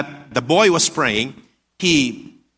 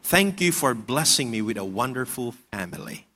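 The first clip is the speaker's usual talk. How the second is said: put-on soft, lowered voice of a praying boy; 6 semitones lower, 6 dB quieter than usual. Little boy praying in his room, in a very soft voice. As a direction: pray quietly and low, as a small boy would. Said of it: "in a very, very soft voice, he uttered these words".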